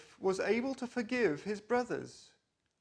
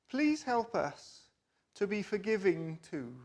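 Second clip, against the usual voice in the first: calm, gentle prayer voice; 2 semitones lower than usual. Acted. Leading the children in a closing prayer, calm and gentle.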